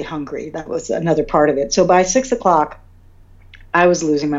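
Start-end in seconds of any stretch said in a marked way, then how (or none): none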